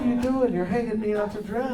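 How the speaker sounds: high-pitched comic complaint; high, put-on indignant voice; mock protest. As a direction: squeal a joking protest at a friend who exposed you. Mock protest at being exposed.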